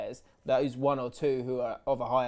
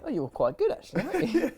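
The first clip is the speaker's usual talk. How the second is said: mock phone call voice; 9 semitones higher, faster than usual. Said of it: "gave me a bell and went".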